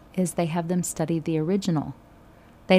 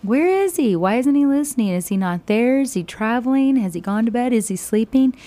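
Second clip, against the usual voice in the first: higher, louder, sarcastic ridicule; mocking taunt; 5 semitones higher, 4 dB louder than usual. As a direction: taunt the false prophets as if their god dozed off. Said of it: "he goes through and he's like".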